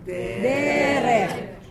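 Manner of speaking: "Derek" with a drawn-out first syllable; teacher showing front stress in "Derek"; penultimate stress. Shown as a demonstration. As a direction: lean on the opening syllable of "Derek". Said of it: "Because it's a segol segol".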